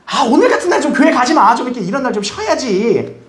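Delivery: high-pitched mock wheedling; nagging plea. Family discouraging church on a holiday, nagging plea.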